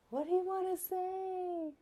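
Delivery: put-on high, gentle coaxing question to a child. Mother coaxing her talkative son to speak.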